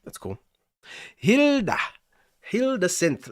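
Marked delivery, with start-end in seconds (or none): 1.2-1.8 high, loud put-on Norwegian lilt; mock Norwegian accent. Streamer announcing a synth app, mock Norwegian accent. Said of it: "pronounce that with, like, a Norwegian accent". 2.4-3.3 higher-pitched playful Norwegian lilt; mock Norwegian accent. Streamer presenting a synth to viewers, mock Norwegian accent. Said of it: "pronounce that with, like, a Norwegian accent".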